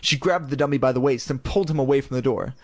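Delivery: plain reading voice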